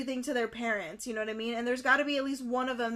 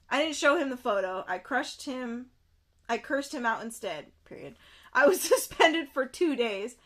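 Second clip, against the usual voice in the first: normal talking voice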